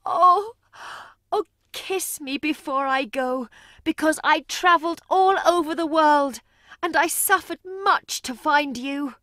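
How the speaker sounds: high, tearful pleading; crying mother. Acted. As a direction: beg one last kiss through tears. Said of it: "The woman cried".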